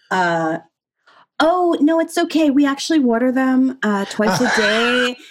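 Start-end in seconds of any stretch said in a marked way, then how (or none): 1.4-5.2 high, over-polite mock server; higher-pitched mock customer-service voice; imagined US barista response. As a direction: play a fussy staffer turning someone down. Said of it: "the response would be".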